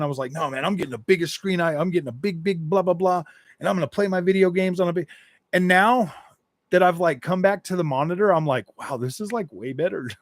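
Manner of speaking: ordinary talk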